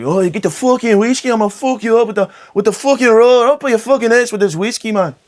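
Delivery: high, loud mock Irish brawler; loud, high hammed-up Irish accent. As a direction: ham up a drunk Irish fighter threatening someone. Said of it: "I don't do accents good".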